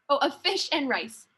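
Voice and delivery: ordinary delivery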